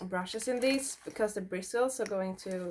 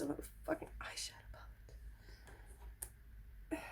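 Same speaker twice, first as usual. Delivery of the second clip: quick hushed whisper, half laughing at herself; rapid awed whisper; fast whisper; 4 semitones higher, 16 dB quieter, much faster than usual. Real, not acted. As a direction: rush it out under your breath. Awe at the expensive palette.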